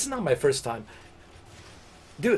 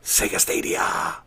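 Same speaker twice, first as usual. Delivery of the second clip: breathy announce. Pretend. Announcing game brands dramatically.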